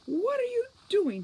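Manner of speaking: breathless, high and loud; panting. Acted. Juliet bursting into the cell, high breathless panting. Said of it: "she panted".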